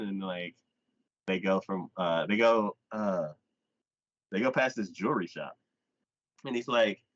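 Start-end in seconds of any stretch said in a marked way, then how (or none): none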